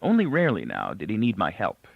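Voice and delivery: plain reading voice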